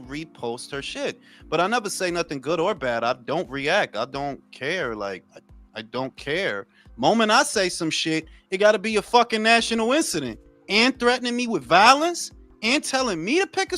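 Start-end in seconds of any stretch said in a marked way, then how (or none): none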